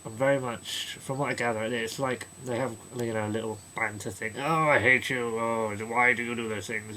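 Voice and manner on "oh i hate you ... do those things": mock bickering. Mock banter between two heroes.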